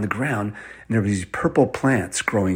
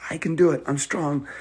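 put-on determined child; 5 semitones higher than usual. Ellie volunteers to carry Mamie.

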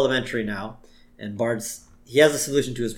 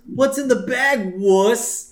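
high, louder playground bully; hammed-up schoolyard jeer; mock bully taunt; 11 semitones higher, 5 dB louder, slower than usual. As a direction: taunt him like a cartoon bully. Nelson bullying Bart, acted.

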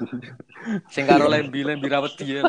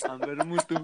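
laughing through the words; 4 dB louder than usual. Real, not acted. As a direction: giggle as you say it. Group laughing at the joke.

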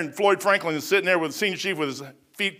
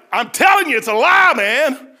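loud protest; 5 semitones higher, 6 dB louder than usual. Retold or imitated. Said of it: "I'm like".

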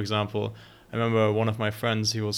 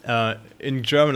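ordinary talk

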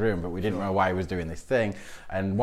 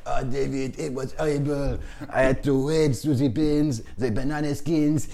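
slow, high-pitched French lament; hammed-up Frenchman recounting a horror, drawn out; French friend's accent; 7 semitones higher, 5 dB louder, slower than usual. French friend tells of wading through rubbish, dramatic French accent. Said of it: "Antoine's telling me this story".

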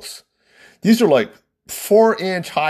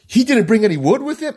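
high-pitched disbelieving question; incredulous bride; 9 semitones higher than usual. Retold or imitated. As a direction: imitate a bride who can't believe it. Bride voiced, disbelieving the answer.

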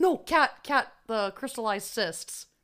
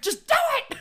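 high, urgent plea; much faster than usual. Real, not acted. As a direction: beg them to get it over with. Urging reader, scared.

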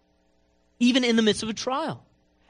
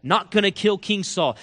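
normal talking voice

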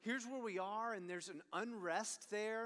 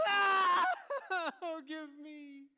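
put-on toddler wail; 8 semitones higher, 9 dB louder, much slower than usual. Toddler tantrum again, teller laughs.

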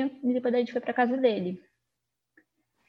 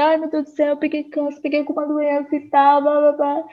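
panicked; 5 semitones higher, 5 dB louder than usual. Retold or imitated. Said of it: "eu falei".

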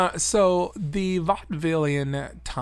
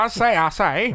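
Foghorn Leghorn; 6 semitones higher, 6 dB louder than usual. Acted. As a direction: bellow it like a blustering cartoon rooster. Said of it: "Foghorn Leghorn?".

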